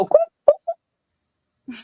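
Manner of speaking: short high-pitched whoops; imitated whoop. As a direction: mimic a bystander's high whoops. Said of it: "I heard her go".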